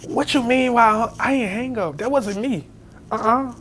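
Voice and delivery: high, protesting, defensive; indignant denial. Retold or imitated. Telling his friend on the phone he didn't hang up, indignant. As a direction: insist you never hung up, worked up.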